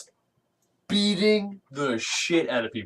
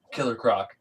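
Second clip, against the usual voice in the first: ordinary delivery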